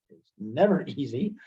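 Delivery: ordinary delivery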